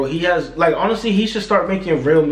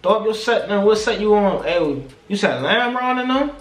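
higher-pitched mock gangbanger interrogation; 5 semitones higher than usual. Imitating gangbangers demanding someone's set, gangbanger voice. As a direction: press like a gangbanger checking someone out. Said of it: "that's how they be".

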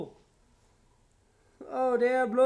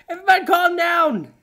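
fast exasperated shout; 6 semitones higher, 5 dB louder, faster than usual. Real, not acted. Begging the chaotic game scene.

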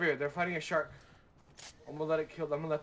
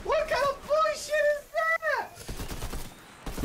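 slow high drawn-out wail; outraged wail; much slower than usual. Real, not acted. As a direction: stretch it out in outrage. Outraged at shark kill.

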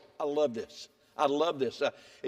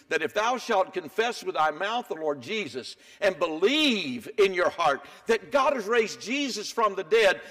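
normal talking voice

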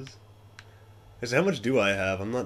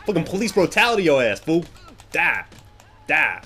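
loud, higher fighting shouts; aggressive yelling; 5 semitones higher, 5 dB louder than usual. Real, not acted. Gamer beating a cop, loud aggressive yells. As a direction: yell as you strike.

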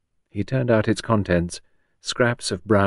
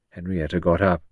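ordinary delivery

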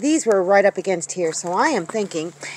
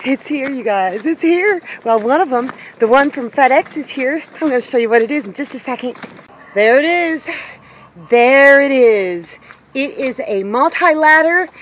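thrilled reveal, raised and louder; excited; 4 semitones higher, 5 dB louder than usual. Real, not acted. Vlogger showing viewers the new delivery, real excitement. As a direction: build up to the big reveal with real delight. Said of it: "I'm so excited".

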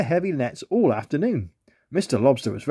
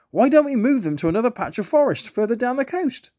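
high, bright-idea plane voice; eager plane; 9 semitones higher than usual. A narrator's reading. Plane suggesting to move the urchins, high and eager. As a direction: pitch the plan with eager lift.

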